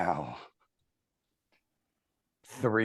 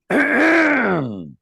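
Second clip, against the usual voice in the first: loud exaggerated throat clear, high and slow; pointed throat clearing; 9 dB louder than usual. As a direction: clear the throat at someone on purpose. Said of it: "she goes".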